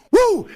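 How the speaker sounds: high, loud Woo! whoop; signature woo yell. Real, not acted. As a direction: let out a wrestler's whoop.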